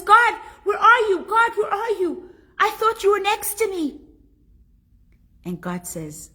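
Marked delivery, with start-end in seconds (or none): 0.0-4.0 high, loud, desperate cry to God. Acted. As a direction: call out panicked, looking for God. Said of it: "he cries out".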